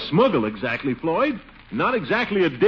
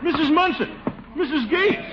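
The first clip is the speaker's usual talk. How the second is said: alarmed shout; 12 semitones higher than usual. Acted. Commissioner cries out in alarm at the women. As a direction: shout out in shocked dismay.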